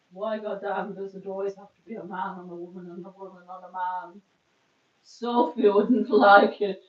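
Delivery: hammed-up Russian accent, ending in a loud laughing burst; a playful attempt at a Russian accent, slowing down at the end. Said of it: "That was my best attempt at a Russian accent".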